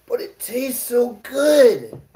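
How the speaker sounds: high, slow, drawn-out "But it tastes so good"; drawn-out savoring. Acted. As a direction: stretch each word out, savoring it. Repeats the line drawn out.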